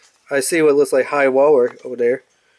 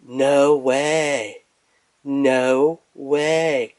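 character quote; much slower than usual. Retold or imitated. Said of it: "Jabana was like".

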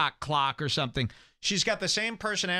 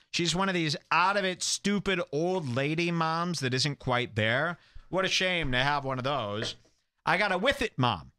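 normal talking voice

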